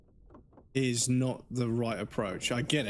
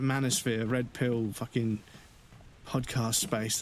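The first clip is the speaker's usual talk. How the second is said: ordinary talk, nothing marked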